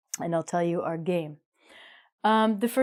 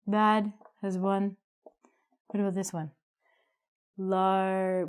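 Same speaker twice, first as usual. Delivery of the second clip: usual speaking voice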